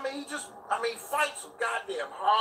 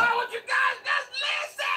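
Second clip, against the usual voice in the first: much higher, shouted parody of him; mock imitation. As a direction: squeal his line back in mockery. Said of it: "He said".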